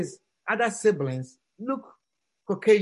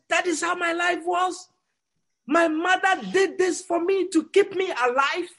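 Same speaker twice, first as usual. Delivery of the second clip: tearful outburst; 8 semitones higher than usual. Acted. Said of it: "the young man broke down, crying".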